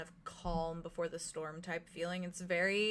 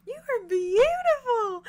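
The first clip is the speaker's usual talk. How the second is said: high, louder, slow swooning praise; fawning admiration; 13 semitones higher, 11 dB louder, slower than usual. Acted. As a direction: gush over her, pitched way up. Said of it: "She's like".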